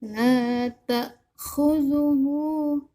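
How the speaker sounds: La ta'khuzuhu drawn very long on the vowel; La ta'khuzuhu slowly, the long vowel stretched out; over enunciating. Shown as a demonstration. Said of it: "Bunya panjang".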